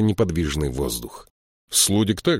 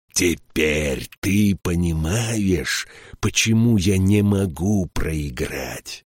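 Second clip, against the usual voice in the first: slow, drawn-out relish; savouring pleasure; slower than usual. A narrator's reading. King questioning the beaten count, savouring it slowly. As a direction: stretch each word out with gloating pleasure. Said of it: "сияя от удовольствия".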